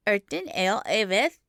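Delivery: lightly voiced small boy, offhand; indifferent child. A narrator's reading. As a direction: toss the name off without interest.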